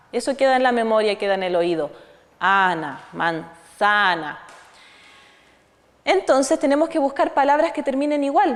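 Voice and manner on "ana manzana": manzana said very slowly and low, with a falling end; slow, lower demo of "manzana"; drawn-out word. Shown as a demonstration. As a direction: linger on "manzana" so the ending is heard.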